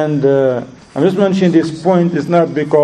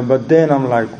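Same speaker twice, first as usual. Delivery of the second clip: ordinary talk, nothing marked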